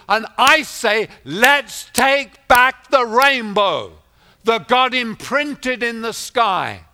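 high, forceful sermon voice; preacher urging on the congregation; impassioned preaching. Real, not acted. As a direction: drive it to a loud rallying cry.

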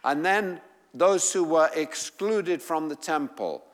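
normal talking voice

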